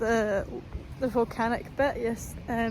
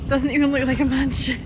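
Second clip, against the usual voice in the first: mocking echo; 3 semitones higher, 8 dB louder than usual. Echoes friend's remark mockingly.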